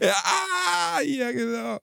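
words squeezed out high through laughter; laughing while talking. Real, not acted. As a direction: barely get the words out for laughing. Laughing at friend's joke.

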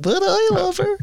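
high, mock-whiny protest; whiny lefty voice. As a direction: echo the wounded lefty, pleading. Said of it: "What was, voice was that?".

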